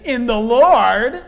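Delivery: very loud, drawn-out retort; booming, triumphant comeback with a rising finish; emphatic comeback. Acted. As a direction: belt it out slowly, stretching the last word upward. Emphatic comeback to demanding husband.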